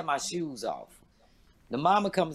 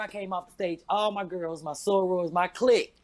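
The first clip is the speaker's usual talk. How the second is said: ordinary delivery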